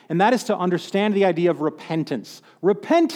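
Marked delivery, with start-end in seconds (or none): 2.6-3.1 high, ringing call of repent; proclaiming. Retold or imitated. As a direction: cry it out like a prophet. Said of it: "he says".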